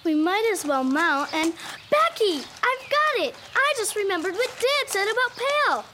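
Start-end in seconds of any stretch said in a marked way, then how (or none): 1.9-5.8 high, eager realisation; sudden excitement. Acted. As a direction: burst out with a bright idea. Sudden idea to get home.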